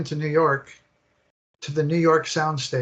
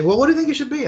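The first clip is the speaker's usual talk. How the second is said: high, louder mock question from colleagues; mimicked colleague question; 11 semitones higher, 5 dB louder, much faster than usual. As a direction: toss the question back like the eager team. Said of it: "and they".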